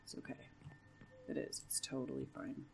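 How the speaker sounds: very soft, nervous self-reassurance; terrified hush. Real, not acted. Player reassuring themself among the monsters, terrified hush. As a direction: convince yourself under your breath. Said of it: "really quiet because I'm terrified".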